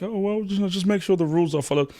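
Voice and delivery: high prissy mock of libertarians. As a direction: pitch way up to mimic them. Voicing libertarians, pitch up.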